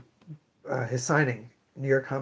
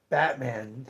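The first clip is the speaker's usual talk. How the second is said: ordinary delivery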